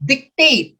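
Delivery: TATE punched in "dictate", light first syllable; second-syllable stress. Shown as a demonstration. Teacher sounding out the answer, "dictate" stressed on second syllable. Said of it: "letter D is second syllable".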